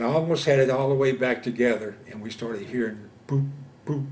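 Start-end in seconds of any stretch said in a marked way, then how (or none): 3.3-4.1 two short hollow boops, spaced apart; imitated mortar thumps. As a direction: imitate distant mortars popping from the tube. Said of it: "We knew mortars were coming in".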